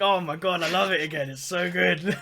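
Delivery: loud, high delighted rave; excited gush. Retold or imitated. Friend retelling falling back in love with the anime. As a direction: gush like a fan who loves it again. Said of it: "And I'm like".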